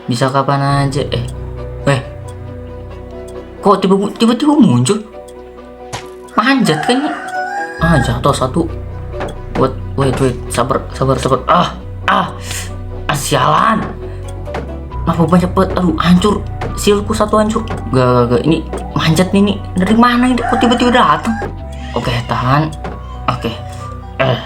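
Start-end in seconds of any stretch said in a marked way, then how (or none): 3.6-7.1 high-pitched startled outburst; startled disbelief. Acted. Player spots a zombie suddenly appearing, startled. 19.8-21.3 high, alarmed questioning; alarmed disbelief. Acted. Player ambushed by climbing zombies, alarmed.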